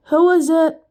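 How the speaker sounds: pleasant gentleman. A narrator's reading. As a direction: call to the door as a pleasant gentleman. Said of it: "in the pleasant voice he stole".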